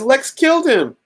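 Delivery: very high Lois voice; hammed-up, high-pitched blame; mock distraught accusation. Lois blaming Lex to Supergirl, hammed-up high distress.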